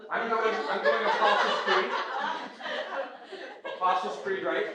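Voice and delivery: chuckling through the words; laughing. Real, not acted. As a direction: laugh while naming it. Teacher laughs at student's guess.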